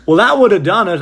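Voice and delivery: high, loud put-on clerk voice; mocking clerk voice. Clerk telling the other clerk, in a high mocking voice. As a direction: sneer it through a laugh.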